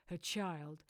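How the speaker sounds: ordinary delivery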